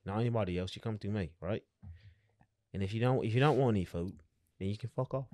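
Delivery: put-on lightly done North London accent; a hint of a cockney lad. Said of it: "you got the accent down".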